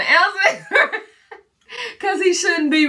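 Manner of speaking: laughing through the words. Real, not acted. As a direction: giggle it out, a bit embarrassed. Embarrassed about answering ex question.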